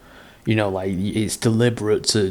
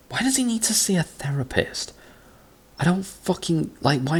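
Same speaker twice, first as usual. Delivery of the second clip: incredulous; 9 semitones higher than usual. Real, not acted. Disbelief at a silly story.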